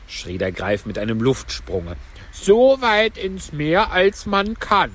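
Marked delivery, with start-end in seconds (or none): 2.3-4.9 high, slow turtle voice; Mock Turtle instructing. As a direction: call the dance steps high and drawn out. (hohe, gedehnte Schildkrötenstimme)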